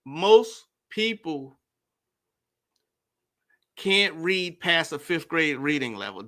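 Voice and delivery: "Most people" drawn out very slowly; deliberately slow. Real, not acted. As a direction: spell it out slowly so everyone follows. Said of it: "So I'm not gonna speak fast".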